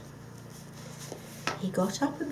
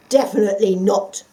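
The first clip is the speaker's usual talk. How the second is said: loud indignant refusal; 12 dB louder than usual. Acted. The dog refusing the fox, indignant and loud. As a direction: refuse him flatly, offended. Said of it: "said the dog indignantly".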